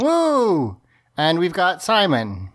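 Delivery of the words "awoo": high mock wolf howl. Echoes the howl.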